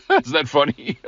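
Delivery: amused question through real laughter; laughing. Real, not acted. Preacher cracking up at his own story, laughing.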